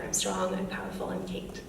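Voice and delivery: hushed but voiced, cautious declaration. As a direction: say it quietly, just finding a voice. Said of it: "she said a little bit louder".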